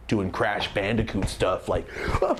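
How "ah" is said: high whooping scream; yelling in the car seat like a game. Retold or imitated. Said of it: "I'm screaming".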